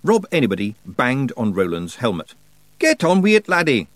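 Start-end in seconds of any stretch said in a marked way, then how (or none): 2.8-3.9 high, loud Scottish wee-man bark; demanding Feegle. Acted. Rob Anybody demanding Roland get on with it, loud and impatient. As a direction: hurry the lad up impatiently. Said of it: "he demanded".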